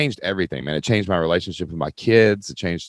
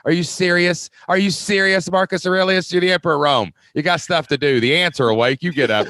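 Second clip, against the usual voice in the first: mock self-scolding emperor; 5 semitones higher than usual. Marcus scolding himself for lying in bed, hammed-up. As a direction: berate yourself for staying in bed. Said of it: "He's like".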